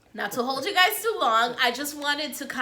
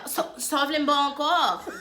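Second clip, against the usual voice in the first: put-on Oka accent; 4 semitones higher, 4 dB louder than usual. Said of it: "I can't even do the accent".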